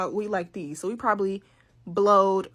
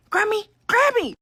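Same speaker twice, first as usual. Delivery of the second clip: much higher and louder, pleading; urgent calling; 13 semitones higher, 8 dB louder, slower than usual. Retold or imitated. Granddaughter calling grandma for help, urgent and high. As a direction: call out to grandma, upset.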